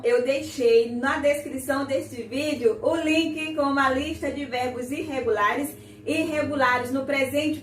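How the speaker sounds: ordinary talk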